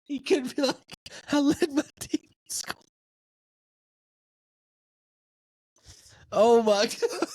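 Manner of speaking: high squeaky words squeezed through laughter; laughing uncontrollably. Real, not acted. Host joking about the lone scorer, helpless squeaky laughter. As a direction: barely get it out between giggles. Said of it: "I had to laugh at something".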